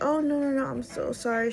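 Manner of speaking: high-pitched mock apology; imitated gushing apology. Lydia apologizes to the late client, high imitated gushing.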